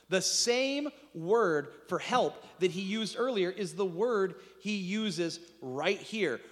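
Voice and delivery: ordinary talk, nothing marked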